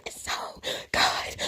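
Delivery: slow, very soft imitated whisper; mocking whisper. Reactor mocking the mukbang host's verdict, whispering. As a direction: mimic her whispering, drawn out and hushed. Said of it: "Now she's whispering".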